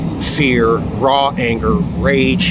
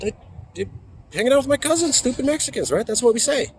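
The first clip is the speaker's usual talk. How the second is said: put-on high, stammering naive teenage voice; 12 semitones higher, 3 dB quieter, faster than usual. Teen answering his angry mom, high and clueless. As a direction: sound like a clueless kid caught out. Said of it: "I go".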